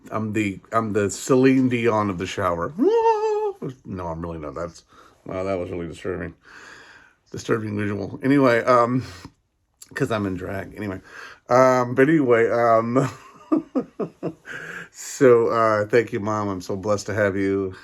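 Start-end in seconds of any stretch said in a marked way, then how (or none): 2.8-3.8 sudden high laugh; high burst of laughter. Real, not acted. Laughs at own joke. 13.5-14.9 giggle. Real, not acted. Laughs after drag joke.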